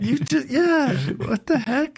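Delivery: high put-on indignant voice; squeaky hammed-up exasperation; mock outrage. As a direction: play the sore loser dad. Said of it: "And I'm like".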